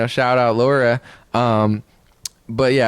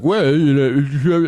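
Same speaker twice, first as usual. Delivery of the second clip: mock slow stall; much slower than usual. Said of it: "He's like".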